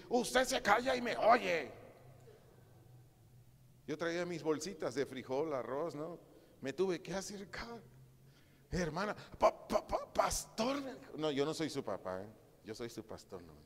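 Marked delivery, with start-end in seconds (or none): 0.1-1.7 high, loud, slow imitated snapping order; mock harsh rebuke; bossy scolding. Sister scolds a woman. 8.7-10.8 high, slow caught-out stutter; mock nervous stammer; flustered stammer. Woman stammering when caught.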